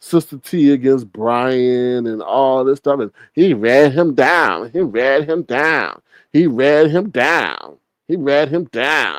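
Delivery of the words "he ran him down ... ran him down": slow, stretched refrain on each word; drawn-out repetition. Real, not acted. Gossip host relishing how he ran Brian down, drawn out. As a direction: draw out each word, savouring the roast. Chanting the roast repeatedly, drawn out.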